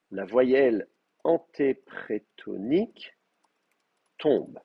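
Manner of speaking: very slow, word by word, with pauses; slow dictation. Real, not acted. Teacher writing a rule for students, dictating it slowly. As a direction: spell the rule out at writing speed.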